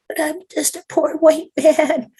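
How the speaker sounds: very high, slow, altered mock whine; whiny poor-me man. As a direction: whimper it like a pitiful victim. Mocking men who play victim.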